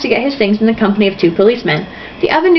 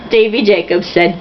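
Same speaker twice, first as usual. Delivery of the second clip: ordinary delivery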